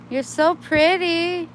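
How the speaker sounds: high, slow cooing with a long so. Real, not acted. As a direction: sweet-talk the kitten. Cooing at a kitten.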